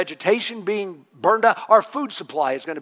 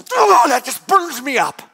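loud, higher indignant cry; angry outburst; 7 semitones higher, 5 dB louder than usual. Acted. Jonah fuming aloud at God's mercy. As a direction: fume like it burns you up.

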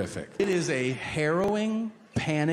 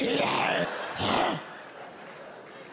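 breathless silent wheeze of laughter; helpless airless cackle; wheezing laugh. Real, not acted. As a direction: laugh so hard no sound comes out. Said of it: "I'm like".

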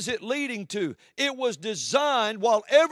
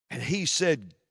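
ordinary delivery

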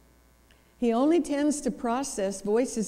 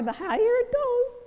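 rising higher; 4 dB louder than usual. Shown as a demonstration. Showing how excited female voices climb, very high pitch. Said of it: "the more excited they are".

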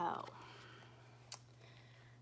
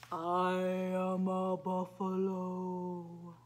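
deep buffalo voice; 7 semitones lower, slower than usual. Acted. Reading to children.

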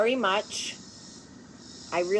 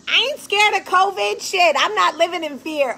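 mock bravado; 10 semitones higher, 7 dB louder than usual. Mocking fearless people.

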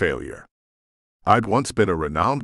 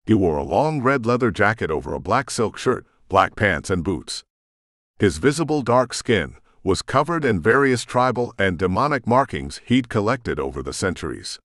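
usual speaking voice

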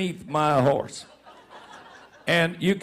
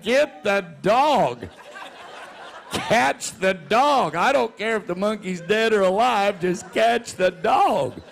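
pleading orders; 2 semitones higher, slower than usual. Retold or imitated. Telling the crew to catch dog.

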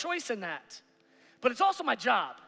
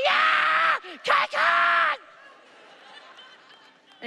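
shrill, far higher yelling cheer; wild cheer; 6 dB louder, much slower than usual. Retold or imitated. Dad cheering daughter across the stage, shouting. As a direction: let it rip like a crazy fan. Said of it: "I was like".

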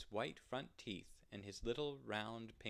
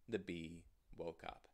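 ordinary delivery, nothing marked

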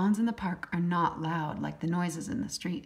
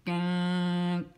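drawn-out honking gunk; big duck honks; much slower than usual. A narrator's reading. Narrator voicing the big ducks hanging back. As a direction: honk slowly like a big wary duck. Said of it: "The big ducks say".

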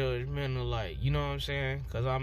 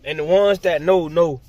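ordinary talk

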